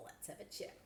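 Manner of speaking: soft unvoiced conspiratorial whisper. To viewers about the next seam guide, conspiratorial whisper. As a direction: lean in like sharing gossip.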